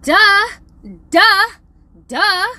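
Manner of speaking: high, very loud duh; screamed duh. Acted. Parent venting about whining son, mock scream. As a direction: blast it out in exasperation. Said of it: "Is all I wanna scream at him".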